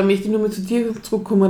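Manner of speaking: ordinary talk, nothing marked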